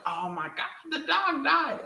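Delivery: high-pitched, wailing imitation of upset bystanders; mock dismay. Imitating everyone learning the dog died, high mock distress. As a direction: squeak out a hammed-up lament. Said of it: "And everyone's like".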